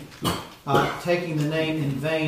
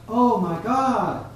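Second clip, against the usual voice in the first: raised, drawn-out cry to God; 7 semitones higher, 4 dB louder, much slower than usual. Acted. Preacher showing someone calling out to God, crying out. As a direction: call it out high and slow, like a plea. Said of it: "when somebody cries out".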